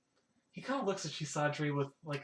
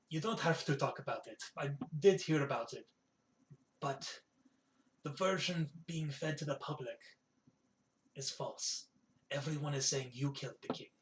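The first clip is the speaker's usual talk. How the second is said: confiding innkeeper; 5 dB quieter than usual. Acted. Innkeeper reassures guest about the false rumour, confiding. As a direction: confide gently, weighing each phrase.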